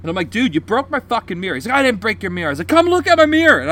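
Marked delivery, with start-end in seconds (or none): 0.3-1.6 raised-pitch mock confrontation; retold angry yelling. Confronting the other driver over his mirror, retold yelling. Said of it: "I'm yelling about this ball bearing". 1.7-2.5 higher, louder indignant denial; retold yelling back. Other driver denying the damage, retold yelling. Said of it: "He's fucking yelling at me". 2.7-3.6 high, loud indignant order; retold angry yell. Driver demanding he look at the mirror, retold yell. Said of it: "I'm yelling about this ball bearing".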